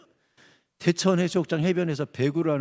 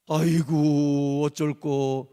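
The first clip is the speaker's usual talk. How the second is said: low, very slow, drawn-out groan of resignation; slow acted dismay; mock lament; 5 semitones lower, much slower than usual. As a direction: sigh it out like a helpless spouse. Acts resigned spouse lamenting.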